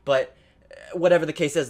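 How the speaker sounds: normal talking voice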